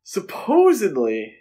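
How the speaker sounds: ordinary delivery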